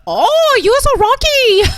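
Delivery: put-on very loud, high caricatured Chinese accent; mock Chinese accent. Joking with friends about an angry accented man, mock Chinese accent. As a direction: shriek it in a hammed-up Chinese accent. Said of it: "I want an angry Chinaman".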